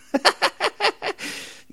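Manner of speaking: a sudden high burst of laughter; real laugh that jumps way up. Real, not acted. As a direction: crack up at your own dark joke. Laughs at own Dahmer joke.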